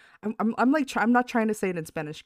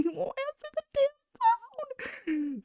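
whiny exasperation; slower than usual. Retold or imitated. Panicked quote to mom.

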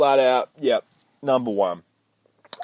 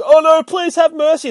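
much higher and louder, hammed-up plea for mercy; mock begging; 10 dB louder than usual. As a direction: whine it like a cowardly soldier pleading. Mocking enemies begging for mercy.

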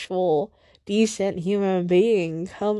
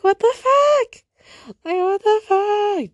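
high shrieking "what the fuck", pitched way up; outraged shriek; 11 semitones higher than usual. Retold or imitated. Venting to listeners about the guy, a high outraged shriek. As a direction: squeal it out in disbelief at his views. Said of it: "'Cause I'm just like".

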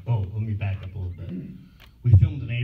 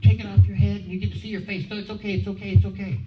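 high-pitched, insistent reassurance; mock soothing, pushed and high; imitated placating girl; 10 semitones higher than usual. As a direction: talk him round in a put-on girl's voice. Mimics girl talking the actor round.

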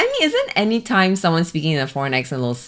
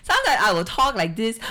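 higher-pitched Singaporean lilt; Singaporean accent; 5 semitones higher than usual. Shown as a demonstration. Comic demonstrating her voice, Singaporean accent. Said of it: "talk with the Singaporean accent".

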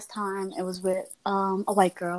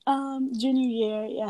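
ordinary delivery

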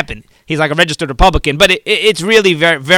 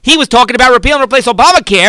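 normal talking voice

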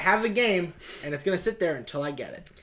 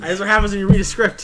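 laughing; 4 semitones higher, 7 dB louder than usual. Real, not acted. Repeats line still laughing.

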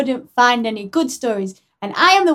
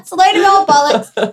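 a touch of stage Irish, louder and punchy; mock Irish accent; 3 semitones higher, 6 dB louder than usual. Co-host shows the host her stage-Irish version. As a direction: answer in a cheeky Irish put-on. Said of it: "help you with the Irish accent".

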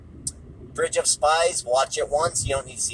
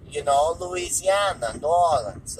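slow imitation of his drawn-out accent; put-on Wiseau-style accent, slow and lilting; Tommy Wiseau; 5 semitones higher, slower than usual. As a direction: stretch the vowels in a mock foreign accent. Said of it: "the Tommy Wiseau accent".

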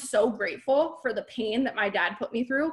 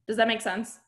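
normal talking voice

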